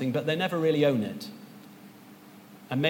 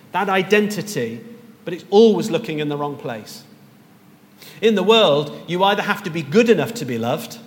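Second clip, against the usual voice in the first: louder, emphatic sermon delivery; loud preaching; 3 semitones higher, 7 dB louder than usual. Acted. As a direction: drive the point home to the church.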